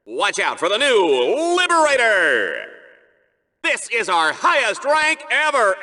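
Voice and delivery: upbeat arena announcer read; game announcer. Acted. Arena announcer calling out Ratchet's ranks and prizes. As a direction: announce each rank and prize with flair.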